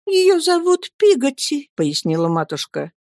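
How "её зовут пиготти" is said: put-on much higher, gentle young woman; a shy young widow answering; timid young mother. Mother voiced in story. (высокий робкий голос матушки)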